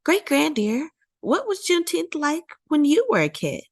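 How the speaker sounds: put-on high child's voice asking; eager little boy wondering; curious child. A narrator's reading. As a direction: ask like a small boy tugging a sleeve. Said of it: "Buddy tugged her sleeve".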